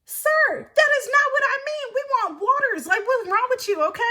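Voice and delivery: high-pitched indignant objection; exasperated protest. Retold or imitated. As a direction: re-enact being fed up and insistent. Acted argument with a pushy man.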